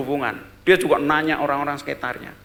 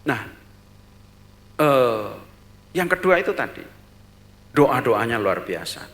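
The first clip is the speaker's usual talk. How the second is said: usual speaking voice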